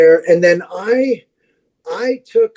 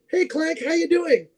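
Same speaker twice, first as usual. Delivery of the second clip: higher and softer, imitating her hello; a bright, mock-cheery hello; cheery friend greeting; 7 semitones higher, 6 dB quieter than usual.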